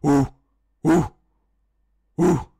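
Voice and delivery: loud, high, forced ooh; forceful monkey hoot. Shown as a demonstration. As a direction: push the hoots out hard. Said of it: "do it with a bit more force".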